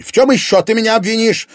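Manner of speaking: very high, loud indignant scream; angry yell. Acted. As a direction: scream back at the accusation. Parents screaming in quarrel.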